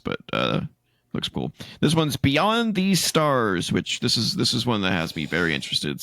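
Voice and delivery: usual speaking voice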